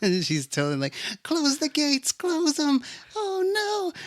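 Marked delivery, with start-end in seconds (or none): 1.2-4.0 high panicked squeal; high mock-terrified wail; terrified little elephant. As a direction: plead like a frightened child. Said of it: "she is terrified".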